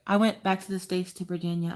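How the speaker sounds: high, put-on sweet voice; Rachel Ray. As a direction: lift the pitch to imitate a very nice lady. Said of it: "And then she's like".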